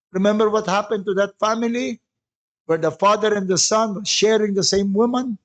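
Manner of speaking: ordinary talk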